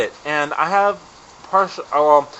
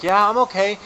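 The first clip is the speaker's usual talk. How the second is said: higher-pitched, put-on okay-ness; acted embarrassment; 7 semitones higher, 4 dB louder than usual. Embarrassed skier to a little girl, acted high reassurance. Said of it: "I am incredibly embarrassed".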